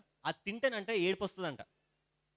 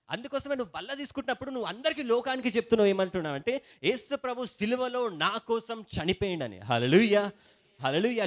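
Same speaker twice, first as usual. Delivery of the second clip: fervent preaching; 4 semitones higher, 7 dB louder than usual. Acted. Pastor rousing the congregation about communion, fervent preaching. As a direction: preach it higher and louder, slowing on key words.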